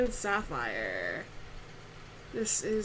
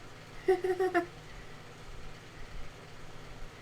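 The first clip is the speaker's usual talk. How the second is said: light laugh; 9 semitones higher, 5 dB quieter than usual. Real, not acted. Laughs at own mistake.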